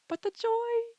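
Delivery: high, loud joyful burst on joy; joyful cry. Pretend. Vlogger exclaiming the joy of parenthood to viewers. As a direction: sing out the joy.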